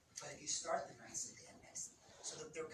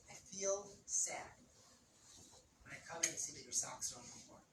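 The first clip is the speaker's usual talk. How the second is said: softened startup; 5 semitones lower, 4 dB quieter than usual. Shown as a demonstration. Partner voices a gentle complaint about socks, softly. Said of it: "here's a softened startup".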